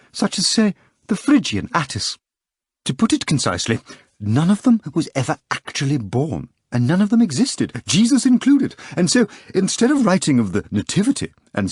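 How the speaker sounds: high, insistent lecturing voice of the editor. Acted. Editor lecturing the young poet, high and insistent. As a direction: hold forth like a know-it-all editor.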